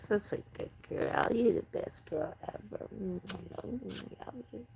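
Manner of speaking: soft whisper to her cat; whispered cat talk. Real, not acted. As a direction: coo quietly to the cat.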